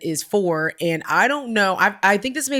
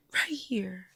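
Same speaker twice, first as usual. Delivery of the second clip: near-whispered little answer; hushed, tiny reply; small hurt child; 11 dB quieter than usual. Retold or imitated. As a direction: imitate an upset child answering very softly. Quoting small upset son.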